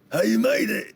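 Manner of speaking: high, loud character call; sudden high-pitched shout of greeting. Acted. Game master springs an approaching voice on the players, high loud call. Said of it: "you hear a voice go like".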